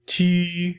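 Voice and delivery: high, loud, held "Tu"; French U. Shown as a demonstration. Teacher reminds learners of French U, held high "Tu". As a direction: hold "Tu" with a rounded-lip ee. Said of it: "French U".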